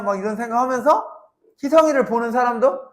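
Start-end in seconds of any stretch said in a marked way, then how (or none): none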